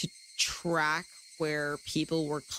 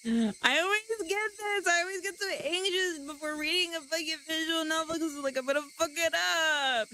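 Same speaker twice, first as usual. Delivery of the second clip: anxious; 10 semitones higher than usual. Real, not acted. Anxious before visual novel.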